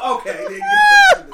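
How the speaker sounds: high, loud laughing; laughing shout. Real, not acted. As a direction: shout it while cracking up. Laughing outburst at the mix-up.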